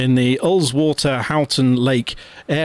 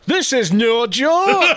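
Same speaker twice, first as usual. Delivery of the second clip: slow, high Geordie with a laugh in it; Geordie, laughing through the words; Geordie accent; 11 semitones higher, 3 dB louder, slower than usual. Pretend. Host reads the poster's warning to his co-host, laughing, in Geordie. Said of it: "I'm gone Geordie".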